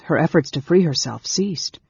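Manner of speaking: usual speaking voice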